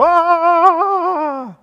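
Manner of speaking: long, high, loud yell; sustained battle cry on one high note; army's war cry. Acted. Israelites answering Goliath at the battle lines, yelling a war cry. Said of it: "shouting its war cry".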